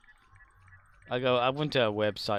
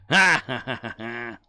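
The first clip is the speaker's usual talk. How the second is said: loud mock villain cackle that drops off quickly; Joker laugh; 9 dB louder than usual. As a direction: ham up a Joker-style laugh. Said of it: "Joker laugh".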